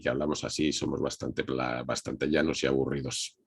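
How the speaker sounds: flat Madrid voice. Shown as a demonstration. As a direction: drop the pitch and keep it dead level. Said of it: "una voz plana como tenemos en Madrid".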